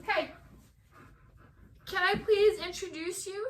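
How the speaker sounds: ordinary talk, nothing marked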